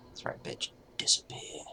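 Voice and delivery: hushed, breathy gloating whisper; whispered taunt. Acted. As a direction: hiss it out as the foe dies. Taunting a killed enemy.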